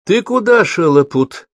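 high, loud nurse's rebuke; scolding shout. A narrator's reading. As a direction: snap at the boy like a strict nurse. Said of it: "прикрикнула на Тимошку молодая развощёкая сестра". (строгий окрик медсестры)

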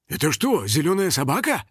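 ordinary delivery, nothing marked

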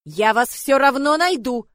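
slow, loud and irritated; angry shout. Acted. Angry lord threatening the hidden girls, loud. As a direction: threaten them as you search. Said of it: "нотки гнева и раздражения".

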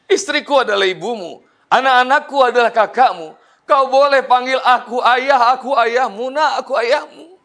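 overwhelmed tearful declaration; tearful outburst. Retold or imitated. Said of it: "Ayahanda Hotman nangis jadi-jadinya".

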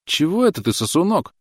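high, surprised scoff. A narrator's reading. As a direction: sound taken aback. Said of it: "удивилась девушка".

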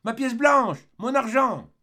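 high, loud desperate shout; a boy shrieking for his money; child's cry. Acted. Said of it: "cria l'enfant".

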